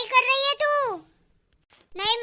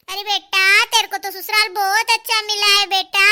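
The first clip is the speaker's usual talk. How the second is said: ordinary talk, nothing marked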